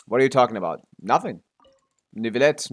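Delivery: ordinary talk, nothing marked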